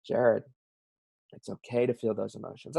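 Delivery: soft, soothing words to oneself; quiet self-comfort; self-talk. Acted. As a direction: gently reassure yourself. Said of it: "I talk to myself".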